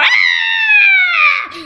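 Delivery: very high, long loud shriek; piercing scream. A narrator's reading. Worm seized by the fox, screaming wildly. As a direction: scream your head off. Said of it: "se met à hurler comme un putois".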